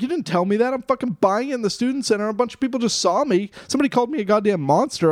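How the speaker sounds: high, mildly worked-up complaint; retold anger. Man confronting girl about the fundraiser, retold anger. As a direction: act out an aggrieved protest, pitch held high. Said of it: "You're getting really mad".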